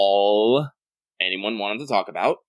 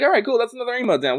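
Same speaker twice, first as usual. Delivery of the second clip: bright, high, pleased exclamation, pitched up; excited; 5 dB louder, faster than usual. Retold or imitated. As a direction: cheer yourself on after finishing an emote. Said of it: "And I was like".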